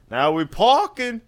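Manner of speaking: high, loud slang voice; clipped street slang call; lazy slang guy. Retold or imitated. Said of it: "It's like".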